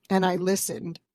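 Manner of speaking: ordinary talk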